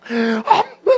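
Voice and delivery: a wailing, sob-choked "no" at a graveside; imitating a theatrical mourner's wail; mock wailing mourner. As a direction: cry out a hammed-up funeral lament. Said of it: "제일 막 서글피 우는 거".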